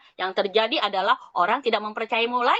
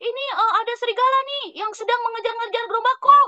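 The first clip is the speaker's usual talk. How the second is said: much higher, fast mock alarm; playful shout of Serigala, much higher; shouting boy; 10 semitones higher, faster than usual. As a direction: cry out to the villagers, hurried. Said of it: "ceritanya dia teriak".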